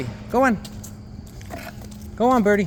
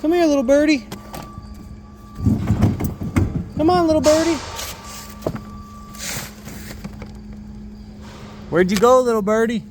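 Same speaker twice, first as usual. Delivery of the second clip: coaxing a bird; 8 semitones higher than usual. Real, not acted.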